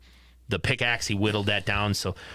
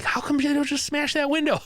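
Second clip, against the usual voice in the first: high-pitched mock exasperation; exasperated viewer. Host heckling horror movie characters over windows, exasperated.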